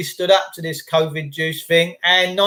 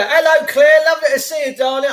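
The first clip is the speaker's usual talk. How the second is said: high, louder, gushing posh hello; mock posh greeting; 8 semitones higher, 3 dB louder than usual. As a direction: gush like a posh hostess.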